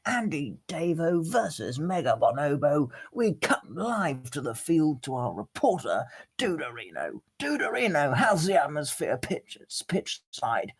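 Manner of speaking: high, bright TV presenter voice; sports announcer. Pretend. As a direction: play the studio anchor handing over. Mock sports broadcast to reporter.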